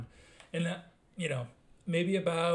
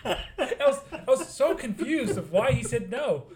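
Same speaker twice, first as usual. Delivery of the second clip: laughing confusion; 9 semitones higher, 7 dB louder than usual. Real, not acted. Retelling baffling coworker story.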